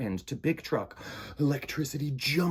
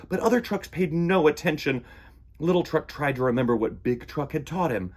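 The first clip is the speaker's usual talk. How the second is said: ordinary delivery, nothing marked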